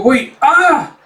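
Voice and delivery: high, loud alarmed cry; panicked cry. Acted.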